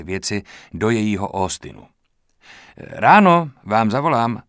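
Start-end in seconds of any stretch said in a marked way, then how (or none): 2.8-4.4 louder, slow call from the car. Acted. Kýbl calling from the car door, loud slow call. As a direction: hoot it across to him, slowly. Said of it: "houkl na mě Kýbl".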